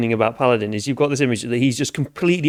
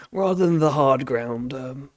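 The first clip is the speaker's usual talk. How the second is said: ordinary talk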